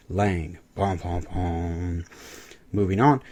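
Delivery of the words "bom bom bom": slow low mock suspense sting; slow dramatic bom bom bom; ominous dun dun dun. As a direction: drag out a horror movie cue.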